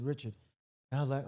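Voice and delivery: ordinary talk, nothing marked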